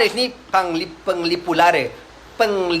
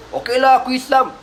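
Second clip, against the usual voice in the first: a higher, eager "okay, I'm in"; giving in; 8 semitones higher than usual. Retold or imitated. Uqbah caving in to the Prophet, eager. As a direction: give in brightly so he will eat.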